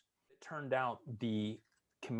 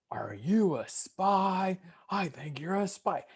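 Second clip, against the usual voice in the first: higher, slow, put-on accusing voice; mock drill instructor; 10 semitones higher, 6 dB louder, slower than usual. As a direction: drag out the accusation like a mocking drill sergeant. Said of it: "and he's like".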